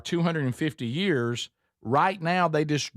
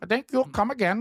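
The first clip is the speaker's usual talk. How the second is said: shaky attempt at an Indian accent, pitched up; trying on an Indian accent, copying the cue; a bad Indian accent, higher; 8 semitones higher than usual. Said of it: "I don't do a great Indian accent".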